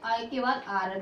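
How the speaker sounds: ordinary talk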